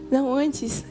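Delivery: ordinary talk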